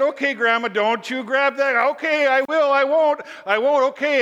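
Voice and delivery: high, loud acted squabble; flustered back-and-forth. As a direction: blurt out hurried promises. Boy and grandma argue, acted.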